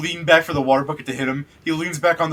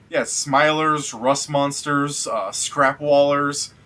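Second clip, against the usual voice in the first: slow listing; much slower than usual. Acted. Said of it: "Guy goes".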